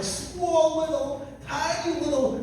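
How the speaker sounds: very high voice on tiny words; playful high-pitched emphasis on littleness. Retold or imitated. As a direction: shrink the voice up high to show smallness. Teller mimes smallness with his voice.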